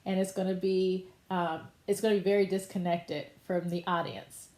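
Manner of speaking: usual speaking voice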